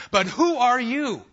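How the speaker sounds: slow booming rebuke from above; booming challenge. Acted. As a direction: thunder it down at the questioner. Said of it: "God's got the questions now".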